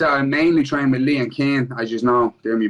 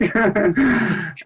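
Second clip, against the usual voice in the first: a high, delighted laugh; giddy laugh of disbelief; high burst of laughter; 9 semitones higher than usual. Real, not acted. Laughs after hearing the news.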